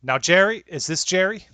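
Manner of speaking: mother scolding Jerry on the phone; scolding mom. Acted. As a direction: tear into him like an angry mother.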